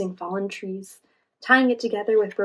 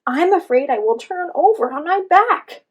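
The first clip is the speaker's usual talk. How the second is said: higher, louder worried plea; anxious cry, much higher and louder; frightened turtle; 8 semitones higher, 10 dB louder than usual. A narrator's reading. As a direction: afraid of flipping over. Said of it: "he cried".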